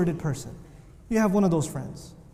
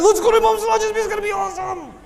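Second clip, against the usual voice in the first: much higher, loud eager voice; hyperactive friend; 14 semitones higher, 6 dB louder, faster than usual. Acted. Hyperactive friend begging to go to the speech, high and excited. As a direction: bounce with excitement. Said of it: "The hyperactive friend".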